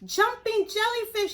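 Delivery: high, loud groan of a little fish; high cartoon fish groaning. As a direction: moan it like a dismayed little fish. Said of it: "he groaned".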